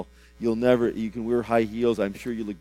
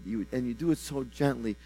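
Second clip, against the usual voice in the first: usual speaking voice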